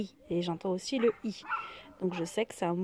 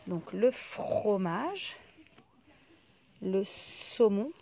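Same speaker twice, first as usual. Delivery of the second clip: slow listing; 3 dB louder, much slower than usual. Shown as a demonstration. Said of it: "listing items slowly".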